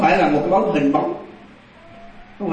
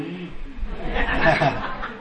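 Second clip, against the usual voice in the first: quiet chuckle; soft laugh; 6 dB quieter than usual. Real, not acted. Storyteller laughs after the ghost's reveal, soft chuckle. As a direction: laugh under your breath.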